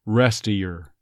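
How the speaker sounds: "rest of your" as "rest-uh-yer"; dropped V. Shown as a demonstration. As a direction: leave the V out of "of" before "your". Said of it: "but when it's your".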